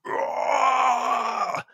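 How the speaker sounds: long, high acted roar; monster roar. Game master voices the slod breaking free of a grapple, roaring. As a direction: roar like an escaping beast. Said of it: "So the slod goes".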